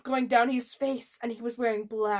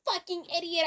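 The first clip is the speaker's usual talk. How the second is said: mock shout; 4 semitones higher, 4 dB louder than usual. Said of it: "I shouted".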